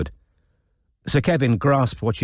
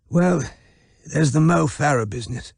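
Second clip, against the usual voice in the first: put-on frail old man; 6 semitones higher than usual.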